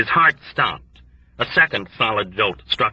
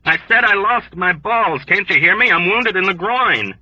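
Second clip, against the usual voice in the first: desperate shout; 9 semitones higher, 3 dB louder than usual. A narrator's reading. Wounded man shouting desperately.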